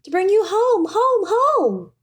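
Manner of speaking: put-on high, eager child's voice; little girl. A narrator's reading. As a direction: play the little sister bursting with joy. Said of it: "brimful of glee".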